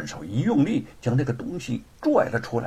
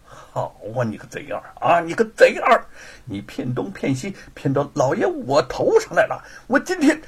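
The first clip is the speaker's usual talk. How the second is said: gnashing-teeth cursing; 5 semitones higher, 3 dB louder than usual. Acted. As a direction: curse through gritted teeth, then erupt. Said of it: "咬牙切齿地骂道". (咬牙切齿地骂)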